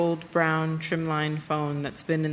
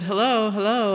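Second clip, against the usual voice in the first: higher, louder "hello, hello" into the line; calling down the phone; 6 semitones higher, 7 dB louder than usual. Retold or imitated. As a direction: check if the caller is still there.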